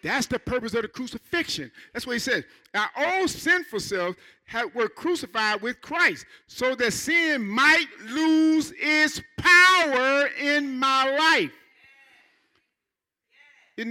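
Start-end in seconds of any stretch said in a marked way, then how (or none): 2.7-11.5 slow, louder, rising to a shout; each word of the verse hammered out slowly; preached verse. Acted. Preacher rereads verse with force.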